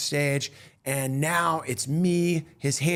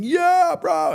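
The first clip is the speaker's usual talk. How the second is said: high, loud hype-bro cheer; hyped congratulation; 14 semitones higher, 5 dB louder than usual. Retold or imitated. Randos high-five the comic coming off stage, hyped congratulation. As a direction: imitate a stoked high-fiving stranger, pitched way up. Randos high-fiving him after his set.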